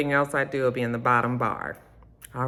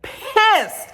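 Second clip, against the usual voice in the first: the word pissed jumps up high and a bit louder; outraged; 4 dB louder than usual. Real, not acted. Imagining being stood up, outraged high exclaim. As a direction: spit it out in real outrage. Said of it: "I would have been".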